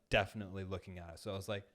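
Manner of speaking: ordinary delivery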